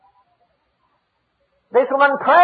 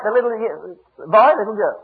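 ordinary talk, nothing marked

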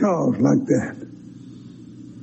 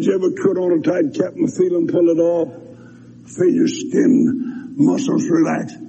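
usual speaking voice

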